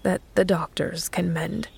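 whispered, trailing off to himself; a sad, hushed confession; very low whisper. Acted. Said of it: "The last words were very low".

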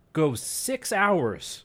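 stretched out slowly for suspense; dramatic slow-down. Acted. Telling friend about the search dog, slow suspenseful build. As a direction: drag it out to build the tension.

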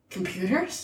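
soft, mock-puzzled question; feigned ignorance. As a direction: quietly play dumb, voice rising at the end. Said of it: "passive-aggressive sarcasm thing".

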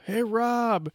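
high, drawn-out call at no great volume; mock yell. Example of calling a friend in the woods, mock yell. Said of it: "I should never yell".

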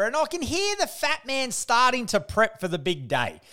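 ordinary talk, nothing marked

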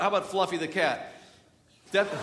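ordinary talk

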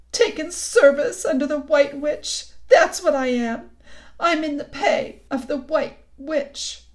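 high, loud lament; wretched confession. A narrator's reading. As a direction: confess it with tearful emphasis. Faun confesses serving the witch.

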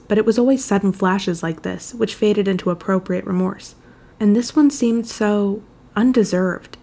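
usual speaking voice

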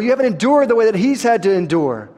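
ordinary delivery

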